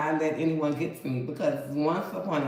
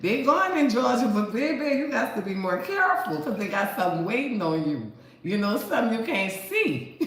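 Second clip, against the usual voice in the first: higher and louder, gleeful taunting warning; loud teasing warning; 6 semitones higher, 4 dB louder than usual. Retold or imitated. To New Orleans fans heading to Georgia, a gleeful loud warning. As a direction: crow it out like you know they're doomed. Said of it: "I said".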